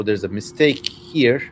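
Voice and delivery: normal talking voice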